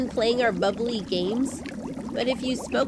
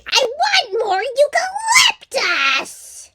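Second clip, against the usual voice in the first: much higher, loud slow screech; screeching koala; 5 dB louder, slower than usual. Acted. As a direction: wail it like a fussy koala. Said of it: "you'd screech and cause quite a fuss".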